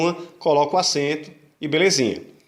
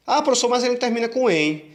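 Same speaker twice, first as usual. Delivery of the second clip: higher-pitched voice of a pupil protesting to the teacher; pupil's higher "but, teacher" protest; objecting student; 7 semitones higher than usual. Acted.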